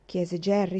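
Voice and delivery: plain reading voice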